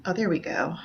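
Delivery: whispered aside. Real, not acted. Quiet aside finding the slide.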